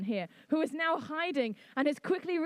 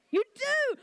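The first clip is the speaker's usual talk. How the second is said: delighted surprise; 11 semitones higher, 4 dB louder than usual. Real, not acted. Delighted crowd knows the song.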